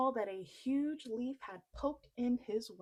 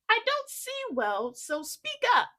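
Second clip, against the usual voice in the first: much higher, loud giantess; 12 semitones higher, 9 dB louder than usual. Acted. Giantess asking Jack to speak up, high and loud. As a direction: call out as a squinting giantess. Half-blind giantess asks.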